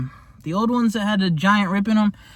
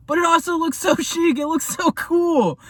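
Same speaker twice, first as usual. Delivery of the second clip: gushing; 9 semitones higher than usual. Real, not acted. Gushing over the spooky burger.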